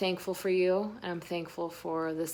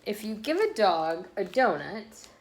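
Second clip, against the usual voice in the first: lively picture-book read-aloud voice; reading to children. A narrator's reading. Reading a picture book to listening children, bouncy and lively. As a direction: read the story to the little ones.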